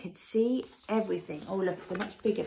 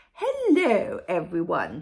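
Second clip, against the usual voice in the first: grand queen; 2 semitones lower, 8 dB louder than usual. A narrator's reading. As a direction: greet the crowd as a posh queen. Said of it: "she said".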